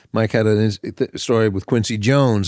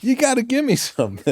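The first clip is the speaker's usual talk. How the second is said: mock [name]; 14 semitones higher than usual. Co-host imitates [name].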